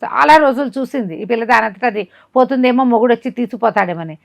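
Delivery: ordinary delivery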